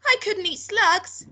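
high, loud, drawn-out protest; horrified cry. A narrator's reading. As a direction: wail it slowly as a disgusted boy. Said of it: "George cried out".

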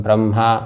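ब्रह्मा said wrongly as 'bram-ha'; म before ह. Shown as a demonstration. Teacher imitating how some people say it, ब्रह्मा as 'bram-ha'. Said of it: "पहले क्या बोलते हैं कुछ लोग?".

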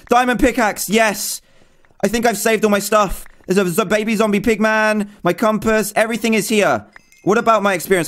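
high-pitched, thrilled rush; elated relief. Real, not acted. Gamer finding the lost gear intact, elated relief.

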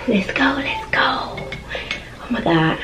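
hushed, eager whisper, the last words drawn out slowly; excited whisper. Real, not acted. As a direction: whisper it like you can't wait to dig in. Excited whisper while opening food.

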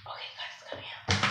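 quick breathy whisper. Real, not acted. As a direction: whisper it fast to yourself. Whispered aside.

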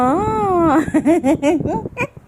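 high, loud giggle. Real, not acted. Mother giggling at her baby, high and bright.

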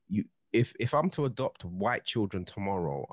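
ordinary talk, nothing marked

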